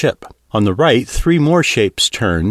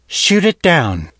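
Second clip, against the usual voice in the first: slow, higher, clipped command; curt order; 6 semitones higher, slower than usual. Acted. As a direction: give the kill order coolly, each word spaced. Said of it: "then announced".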